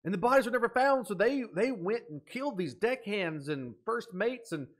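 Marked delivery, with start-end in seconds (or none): 0.0-3.5 high, loud and fast eureka; blurting out the answer he just cracked; thrilled shout of discovery; excited realization. Real, not acted. Solving the mystery aloud.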